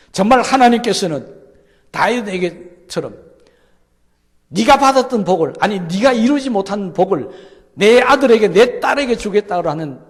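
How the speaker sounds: loud, high, ringing climax of the sermon; fervent preaching. Real, not acted. Pastor crying out God's promise to the congregation, loud and high. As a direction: proclaim it at full voice to the whole room.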